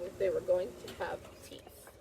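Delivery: secretive unvoiced whisper; spooky storytime whisper. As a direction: hush it like a secret for kids. Teacher reads story to children.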